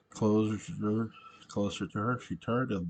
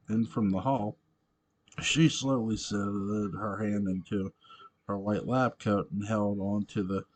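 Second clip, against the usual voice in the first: ordinary delivery, nothing marked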